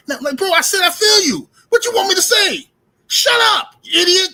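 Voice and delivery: exasperated friend snapping back loudly; angry shout. Acted. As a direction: blow up at your buddy in exasperation. Acted friend loses temper, shouts.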